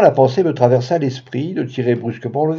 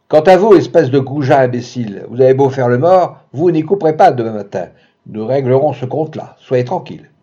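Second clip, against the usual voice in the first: louder shouted threat; 4 dB louder than usual. Acted. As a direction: threaten him through the wall. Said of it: "Il me cria". (menace criée, plus forte)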